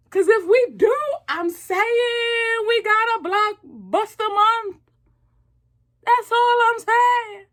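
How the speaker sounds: high, slow, emphatic rant; excited. Real, not acted. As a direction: hammer the point home with excitement. Excited rant about good movies.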